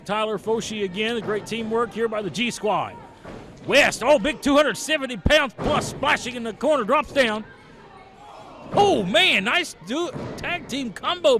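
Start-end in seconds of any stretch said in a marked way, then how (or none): 3.6-11.4 loud, rising excitement at a big move; excited shout. Real, not acted. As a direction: call it out like the crowd just erupted. Big splash in the corner.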